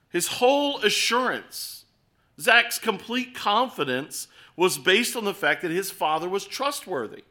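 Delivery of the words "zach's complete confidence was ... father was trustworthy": usual speaking voice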